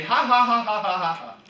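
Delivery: hammed-up gloating ha-ha at the beaten enemy; mock taunting laugh. English archers jeering at the beaten French, with a mocking laugh. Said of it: "the English would be".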